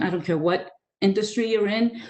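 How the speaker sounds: ordinary talk, nothing marked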